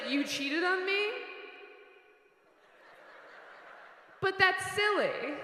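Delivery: breezy forgiving friend. Retold or imitated. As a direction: brush off the cheating like it's cute. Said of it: "Just someone who's like".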